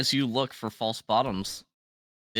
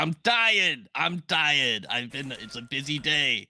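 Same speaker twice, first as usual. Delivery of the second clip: lazy skull voice; 4 semitones higher than usual. Acted.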